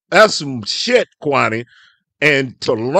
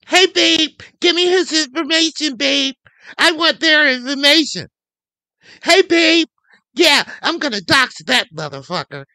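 much higher, sing-song voice mimicking Tracy; mocking Tracy; 9 semitones higher than usual. Vlogger mocks Tracy asking her babe for info, high mock voice. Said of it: "when Tracy does it".

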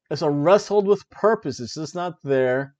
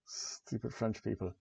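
mock muttered insult; 6 semitones lower, 15 dB quieter than usual. Mock English archer insult.

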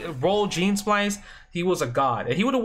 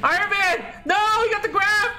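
very high-pitched frantic yelling; panicked outcry; slower than usual. Real, not acted. Gamer watching his opponent grab and drop Iron Man, panicked outcry. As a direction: cry out in high-pitched disbelief. Opponent grabs him mid comeback.

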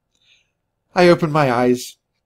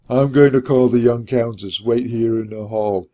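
gruff, put-on servant voice; instructing servant; 3 dB louder than usual. A narrator's reading. Hunchback instructing the visitors to wait, gruff. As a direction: give orders in the surly doorman's voice. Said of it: "he instructed".

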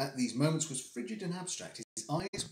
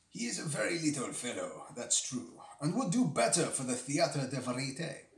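a deliberately bad French accent; poor French accent. Reader playing Dupin in a poor French accent. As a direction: ham up a clumsy French accent. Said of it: "here comes a very poor French accent".

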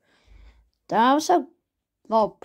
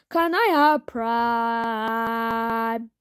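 slow drawn-out plea; 3 semitones lower, much slower than usual. Acted. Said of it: "Prime, give me Prime".

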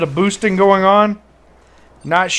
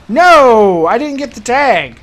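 loud yelled no; frustrated shout; 5 semitones higher, 6 dB louder, slower than usual. Real, not acted. Gamer missing a tag, yelling in frustration.

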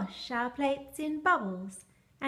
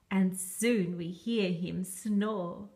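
plain reading voice

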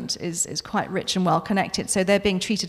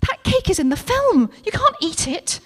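alarmed; 10 semitones higher, 3 dB louder than usual. Acted. Suze scolding Becky on set.

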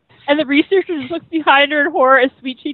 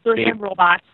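toy robot voice; 3 semitones lower, 3 dB quieter, slower than usual. Real, not acted. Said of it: "We all still sound like robots".

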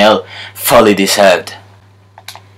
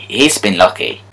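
usual speaking voice

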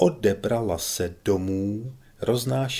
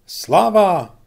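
high, much louder cheering shout; crowd cheer; 10 semitones higher, 12 dB louder than usual. A narrator's reading. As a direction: shout it as a whole crowd of twenty. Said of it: "zvolalo dvacet hlasů".

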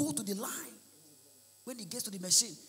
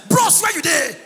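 calling out; 8 semitones higher, 11 dB louder than usual. Retold or imitated. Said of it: "they ask useless question".

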